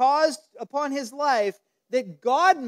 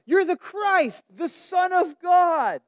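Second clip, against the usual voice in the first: higher, eager outburst; blurted out; 6 semitones higher, slower than usual. Retold or imitated. Peter answering Jesus, blurting it out higher and excited. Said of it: "Peter blurts out".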